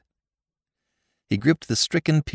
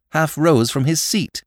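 usual speaking voice

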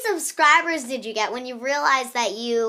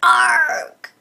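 louder pirate "Arg"; pirate growl; 5 semitones higher, 9 dB louder than usual. Acted. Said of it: "I can't really do a pirate".

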